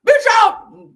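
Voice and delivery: high, loud exclamation; excited shout. Real, not acted. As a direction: shout it out to the chat, pitch way up. Hyping up the chat mid-rant.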